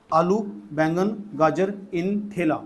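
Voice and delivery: slow, drawn-out list for memorising; mnemonic recital. Shown as a demonstration. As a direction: recite it slowly, word by word. Teacher recites a memory trick. (याद कराने के लिए धीरे-धीरे बोलते हुए)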